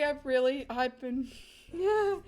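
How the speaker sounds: usual speaking voice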